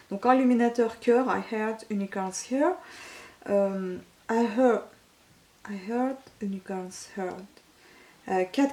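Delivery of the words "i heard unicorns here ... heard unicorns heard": English with a real French accent. Real, not acted. Vlogger reading a product name aloud, French-accented English. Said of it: "Je suis désolée pour mon accent english".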